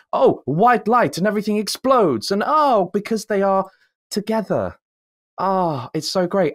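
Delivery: sarcastic swoon over the lovers; mock rapture. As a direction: ham up the swooning climax. Mocking the big romantic climax.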